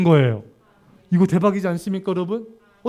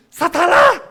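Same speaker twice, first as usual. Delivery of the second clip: much higher, loud playful shout; mock rebuke; 9 semitones higher, 8 dB louder, slower than usual. Pastor joking about rebuking a member, loud and high. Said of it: "누가 여러분들 주님의 일을 안 할 때".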